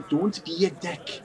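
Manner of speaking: slow, lightly lilting elvish version; mock Elvish. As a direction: say Don't be a dick as if in Elvish. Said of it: "tell you how to say it in Elvish".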